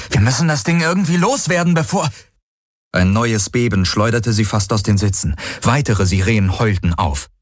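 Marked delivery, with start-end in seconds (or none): 0.1-2.1 high-pitched, pressing urgency; urgent alarm. A narrator's reading. Pilot urges escape from the monster, urgent. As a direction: press on until cut off. Urgent plea cut off by quake.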